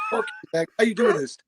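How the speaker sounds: friend retorting. Retold or imitated. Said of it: "He's like".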